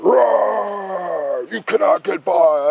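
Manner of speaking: loud, high roaring giant voice. Retold or imitated. Giant blocking the son's spaceship, loud roaring. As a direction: roar it out like an angry giant. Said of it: "the giant jumped up and went".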